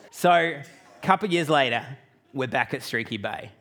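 usual speaking voice

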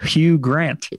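slow "Hugh Grant" with an audible H; sounded H. Shown as a demonstration. Showing the proper way, "Hugh" with its H sounded. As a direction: stretch out "Hugh Grant", breathing the H in HYOO. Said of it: "you're supposed to say".

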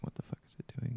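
breathy, hushed confusion at a strange spider; puzzled whisper. Retold or imitated. Gamer whispering to himself at the screen, baffled. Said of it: "you're like".

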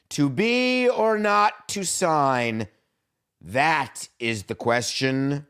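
high, slow, grand theatrical recital; hammed-up Shakespeare. Mock serious offer read as a hammy stage recital. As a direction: declaim it like a ham stage actor. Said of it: "I'm gonna be very serious now".

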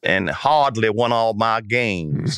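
slow, stretched Louisiana drawl; mock Cajun drawl. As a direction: draw out every word like a Cajun. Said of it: "drawn out words".

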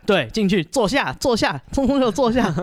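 hammed-up SWAT officer ordering dogs down; mock SWAT commands. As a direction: command the dogs like a tactical squad. Acts police raid on dogs.

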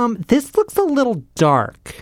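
high, slow, fussy client voice; client impersonation. Retold or imitated. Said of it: "typical client impersonation".

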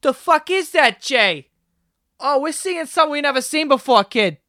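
high-pitched, excited Boston guy impression; Boston accent. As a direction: exclaim like an amazed Boston local. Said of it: "he was from Boston".

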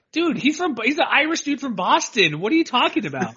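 high-pitched, baffled objection; incredulous. Real, not acted. Mocks coach's put-on Southern accent.

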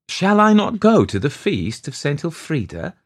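high, incredulous question; protesting cry. A narrator's reading. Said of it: "cried Iseult".

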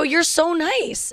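high, drawn-out gushing thanks; gushing gratitude. Retold or imitated. Traveler retelling her thanks to the security officer, gushing.

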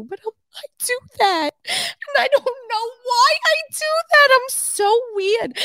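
high squeal through real laughter; very high, breaking into giggles; laughing helplessly. Real, not acted. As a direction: squeak the confession out, cracking up. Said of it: "I can even say it with a straight face".

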